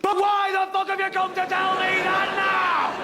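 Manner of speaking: high, drawn-out angry yell; enraged, high-pitched demand; furious outburst. Acted. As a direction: scream back at the apology. Furious reply to the apology.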